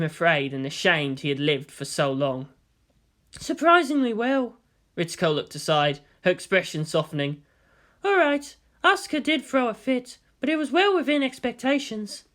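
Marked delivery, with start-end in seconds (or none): 3.4-4.6 higher, lightly voiced female scientist; woman doctor voice. 8.0-12.2 higher, lightly voiced female scientist; woman doctor voice.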